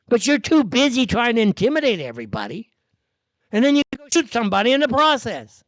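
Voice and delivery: loud, fast, high angry scolding; angry rant. Real, not acted. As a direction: tear into the officer, raising the voice. Ranting at the ICE officer.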